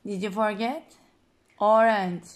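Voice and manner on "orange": clear model word. Shown as a demonstration. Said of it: "mother models word for child".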